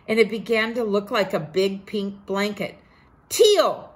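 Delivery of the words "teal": high, loud scream; boy Ko screaming at Tio to stop spilling. Acted. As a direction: shriek it out in alarm. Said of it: "screamed Ko".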